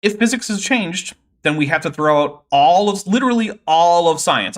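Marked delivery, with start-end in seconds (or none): 2.5-4.6 heavy stress on both "all"s in "all of, literally all of science"; emphatic. Real, not acted. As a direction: punch "all" hard each time, louder and higher. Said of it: "I want to emphasize this".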